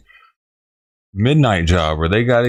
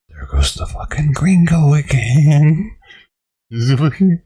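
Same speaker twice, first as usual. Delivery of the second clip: a hint of a gossiping neighbor, slowed; imitating the neighbors; 5 semitones higher, 3 dB louder than usual. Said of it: "my entire neighborhood's like".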